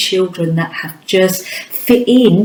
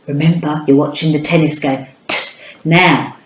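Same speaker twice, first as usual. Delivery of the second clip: ordinary delivery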